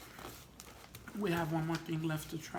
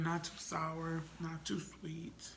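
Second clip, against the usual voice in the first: slow; slower than usual. Real, not acted. Measured verdict on candy.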